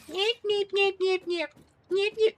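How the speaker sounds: tiny squeaky critter chirping very high; squeaky creature voice. Pretend.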